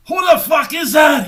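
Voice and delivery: high, loud outburst imitating a manager; retold startled shout. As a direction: yell it in disbelief down the phone.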